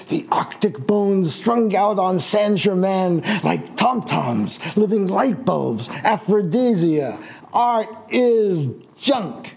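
slow declaiming performance voice; poetry reading. Acted. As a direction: declaim each image deliberately. Reads his own poem aloud.

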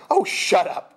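high, loud irritated outburst; flaring temper. A narrator's reading. Jeff cutting off the sniper's jibe, flaring up. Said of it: "Jeff flared".

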